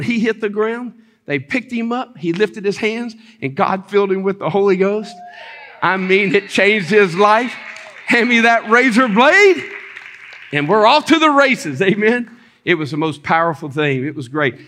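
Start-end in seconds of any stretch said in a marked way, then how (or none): 5.8-12.4 loud, high pulpit climax; punched-out, exultant preaching; fervent preaching. Real, not acted. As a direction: drive it home to the congregation.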